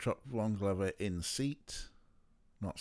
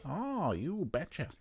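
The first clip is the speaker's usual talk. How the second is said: slow, higher Midwest "Oh, you betcha"; Midwest accent; 6 semitones higher, slower than usual. Retold or imitated. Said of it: "I like a bit of the Midwest".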